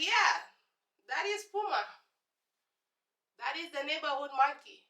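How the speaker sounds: feed the fool a straight-faced lie; sarcastic deadpan. Retold or imitated. Sarcastic reply to classmate.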